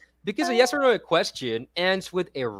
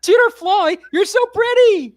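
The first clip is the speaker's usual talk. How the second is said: much higher and louder, gushing with a laugh; excited exclamation; 10 dB louder than usual. Shown as a demonstration. Tutor exclaiming excitedly to her colleague. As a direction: gush it out like a thrilled compliment. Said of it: "I would say something like this".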